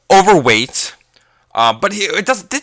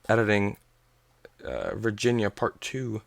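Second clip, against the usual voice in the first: ordinary talk, nothing marked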